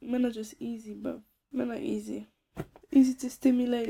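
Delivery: ordinary delivery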